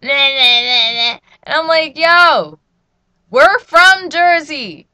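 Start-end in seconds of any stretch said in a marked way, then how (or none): 0.0-1.2 loud, retold road-rage grumble; loud rant trailing into mocking nonsense syllables; mom's mock ranting. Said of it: "she be like". 2.0-4.9 high, very loud, drawn-out retort; loud retold exasperation, pitched way up; indignant protest.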